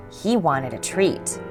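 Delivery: ordinary delivery, nothing marked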